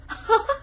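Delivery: quick high giggle; short giggle. Acted. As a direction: giggle once. (छोटी सी हंसी)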